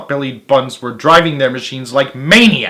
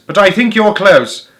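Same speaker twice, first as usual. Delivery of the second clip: higher, louder shout; 7 semitones higher, 5 dB louder than usual. A narrator's reading. Fat Boggis shouts to Bean, higher and louder. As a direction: yell over the engines. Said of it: "shouted Boggis".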